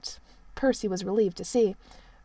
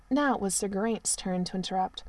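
ordinary delivery

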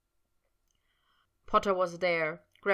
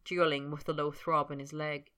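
ordinary delivery, nothing marked

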